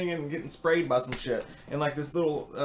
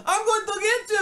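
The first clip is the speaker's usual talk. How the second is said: Kruger; 5 dB louder than usual. Retold or imitated. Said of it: "'cause when he speaks".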